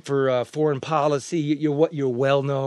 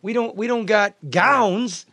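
loud, high, exasperated ranting; heated rant about missing supplies; indignant rant; 7 semitones higher, 5 dB louder than usual. Real, not acted. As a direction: vent the outrage at full voice.

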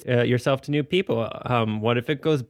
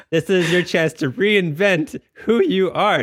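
dramatic announcer; 7 semitones higher, 5 dB louder than usual. Acted. Mock dramatic pitch.

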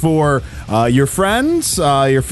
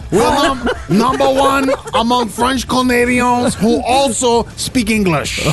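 high, slow mock French; hammed-up French bragging, dragged out; worst French accent; 6 semitones higher, slower than usual. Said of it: "This is the worst French accent ever".

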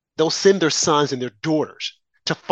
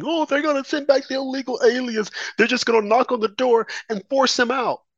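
higher, whiny parody of a fretting liberal; mock crying liberal; 8 semitones higher than usual. Mocking liberals who cried over deportations, in a higher whiny voice. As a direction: whine it like a panicked liberal. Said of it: "bleeding liberals, crying".

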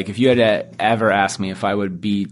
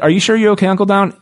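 put-on concerned kids; 9 semitones higher, 4 dB louder, faster than usual. The boys asking Donald worriedly.

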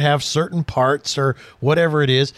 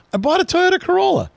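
eager reveal; 14 semitones higher, faster than usual. Retold or imitated. Friend's surprising car reveal.

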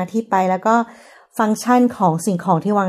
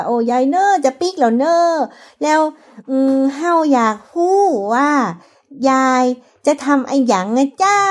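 put-on Lamphun Yong accent, pitched higher; สำเนียงยองลำพูน; 4 semitones higher than usual. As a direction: speak to granny in a sing-song northern Thai dialect, higher. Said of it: "คุยกับชาวยอง หรือคุยกับคนท้องถิ่น". (พูดคำเมืองสำเนียงยอง เสียงสูงขึ้น)

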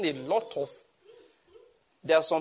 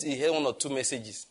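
ordinary talk

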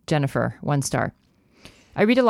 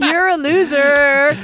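high, loud taunting gloat; 10 semitones higher, 6 dB louder, slower than usual. Real, not acted. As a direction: rub the win in. Repeating the taunt.

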